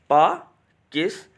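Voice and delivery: पाकिस्तानी spaced out as पा - किस - ता - नी; split into syllables. Shown as a demonstration. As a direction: demonstrate the four syllables of पाकिस्तानी one at a time. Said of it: "फिर से सुनिएगा कैसे". (पाकिस्तानी का हर syllable अलग-अलग, रुक-रुककर)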